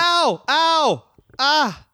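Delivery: high sharp yelps of pain; burnt fingertips. Pretend. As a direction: yelp like a burnt hand. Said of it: "I burnt my hand on that sizzling hot take".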